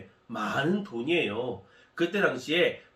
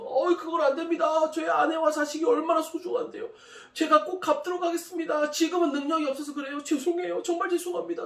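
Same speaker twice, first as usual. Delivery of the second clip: much higher, crying plea for mercy; tearful begging. Acted. Servant begs the master to spare his wife and children, high and tearful. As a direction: beg through tears as if your family depends on it. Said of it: "눈물을 뚝뚝 흘리면서 막 싹싹 빌면서".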